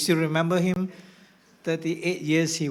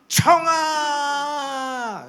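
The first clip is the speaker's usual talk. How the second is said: mock battle cry; 9 semitones higher, 7 dB louder than usual. Mock reckless charge cry.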